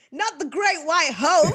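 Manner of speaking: quoting Tommy. Retold or imitated. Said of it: "Tommy saying".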